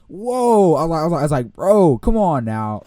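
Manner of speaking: outraged disbelief. Retold or imitated. Student watching his own paper burned, loud high protest. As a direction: protest like your work was just burned.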